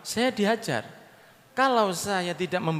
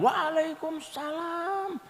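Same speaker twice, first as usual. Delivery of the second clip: high, drawn-out reply of the women inside; women's reply; 11 semitones higher, 5 dB quieter, much slower than usual. Acted. Women inside the house answer the Prophet, high and drawn out. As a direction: stretch it slow and high like a woman answering.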